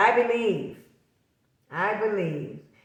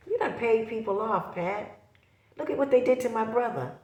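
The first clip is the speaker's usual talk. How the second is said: higher, fast, indignant jab; heated accusation; 5 semitones higher than usual. Real, not acted. Confronting Pat over taking her brother's daughter, indignant and heated.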